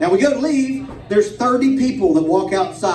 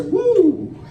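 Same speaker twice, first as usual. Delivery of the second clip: high, drawn-out whoop; mock reeling "woo"; 11 semitones higher, 3 dB louder than usual. Cop leans into the car, high reeling whoop. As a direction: let out a high "woo" as if knocked back.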